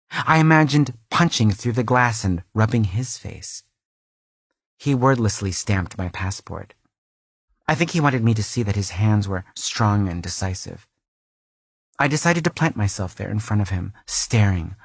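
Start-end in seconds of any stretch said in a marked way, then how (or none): none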